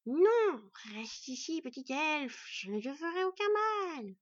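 high, loud, hissing monster voice that coaxes; a giant spider luring its prey, hissing. A narrator's reading. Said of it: "Le chuintement se fit furieux".

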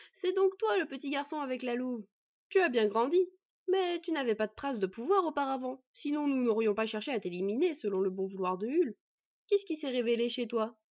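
loud, swaggering villain voice; hearty bandit chief. Acted. Bandit recognising the boy he hunted, swaggering. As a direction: size him up loudly, with swagger.

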